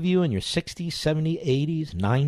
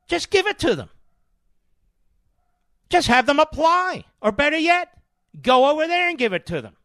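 sarcastic; 10 semitones higher, 4 dB louder than usual. Retold or imitated. Mocking welfare giveaways.

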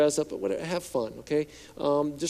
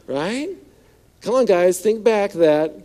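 ordinary talk, nothing marked

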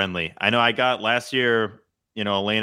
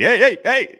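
loud, much higher repeated heys; mock high-pitched heys; 13 semitones higher, 6 dB louder than usual. Co-host imitating people stopping field intruders, high mock shout. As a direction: yelp hey in a raised voice. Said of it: "the voice just gets higher each time".

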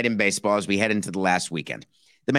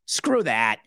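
normal talking voice

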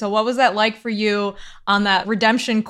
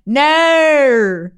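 loud, higher, exaggerated Aussie "No"; mock Australian accent; 5 semitones higher, 7 dB louder than usual. Host makes fun of Australians, mock Australian accent. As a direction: poke fun with an overblown Australian vowel. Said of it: "make fun of the Australian accent".

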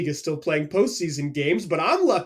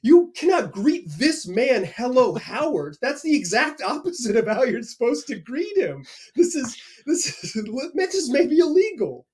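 mock outrage; 7 semitones higher than usual. Young reporter protesting to himself about the greeting, mock outrage. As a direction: act shocked, half laughing. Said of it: "I'm like".

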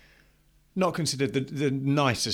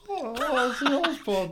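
put-on very high, slow Irish boy's squeal; high Irish kid impression; 5 dB louder than usual. Co-host copying the kid, high drawn-out Irish voice. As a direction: drag it out high and loud, mock Irish lad.

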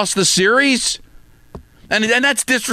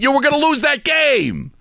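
exasperated shout; 6 semitones higher than usual. Real, not acted. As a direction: yell it at the coach in disbelief. Ranting host rebukes coach.

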